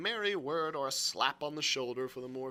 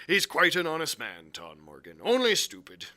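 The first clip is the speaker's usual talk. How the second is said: usual speaking voice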